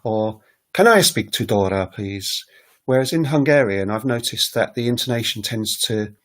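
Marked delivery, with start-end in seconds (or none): 0.7-2.4 front-load the weight on "Can I", then tail off; stress at the beginning. Shown as a demonstration. Teacher showing un-English emphasis, stress on opening "Can I". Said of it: "we wouldn't say".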